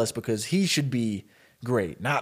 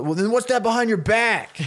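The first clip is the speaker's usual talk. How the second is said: high-pitched accusing question; taunting challenge; 9 semitones higher than usual. Retold or imitated. Kid challenging Ben over the hidden Pokeball, taunting. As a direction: call out his bluff, pitch climbing.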